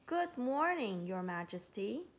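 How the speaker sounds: ordinary delivery, nothing marked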